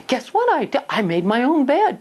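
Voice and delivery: mock child's excited announcement, pitched high; proud child. Child telling parent they made the bed, excited. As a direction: imitate a child bursting with news. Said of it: "the child comes to you".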